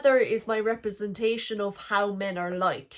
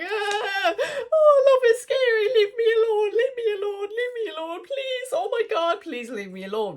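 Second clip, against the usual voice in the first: high, hammed-up tearful whine, much higher; mock fearful whine; 11 semitones higher than usual. Imitating her old scared self, pleading in a high mock-crying whine. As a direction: overact the scared crybaby pleading to be left alone. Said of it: "that isn't how I used to think".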